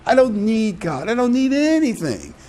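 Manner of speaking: high, dismissive spoiled person; a hint of a self-satisfied brat; mock smug voice. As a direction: toss it off like someone who needs nothing.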